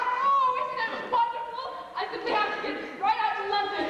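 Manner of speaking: high, breathless-with-joy gushing; girlish squeals. Acted. Actress tells friends of her engagement, thrilled. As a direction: bubble over with the news. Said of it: "your girlish squeals".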